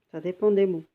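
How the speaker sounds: usual speaking voice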